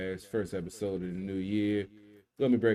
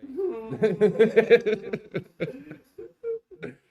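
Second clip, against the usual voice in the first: big high burst of laughter; loud laugh; 9 dB louder than usual. Real, not acted. Laughs at his own joke.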